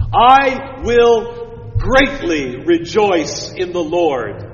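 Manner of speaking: slow, weighty, stretched words; slow solemn reading. Shown as a demonstration. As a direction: read it slow and grand, word by word. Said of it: "it's even more emphatic than that".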